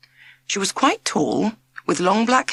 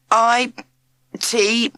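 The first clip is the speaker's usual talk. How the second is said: halting, nervous letters with long gaps; stammered; 6 semitones higher, 4 dB louder than usual. A narrator's reading. Jack stammering as he tries to spell for the teacher. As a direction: caught out and unsure, getting the letters out. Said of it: "he stammered".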